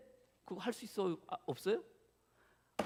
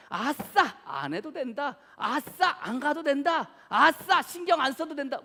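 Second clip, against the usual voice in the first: higher, louder, jubilant yay; gleeful inner cheer; 8 semitones higher, 9 dB louder, slower than usual. Pretend. Pastor voicing the congregation's inner glee, higher and louder. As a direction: celebrate like a kid let off school. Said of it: "여러분들 속으로".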